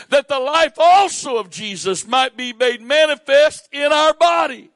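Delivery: high, loud, impassioned scripture preaching; preacher building to a roar; preaching at full force. Real, not acted. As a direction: thunder each line to the church.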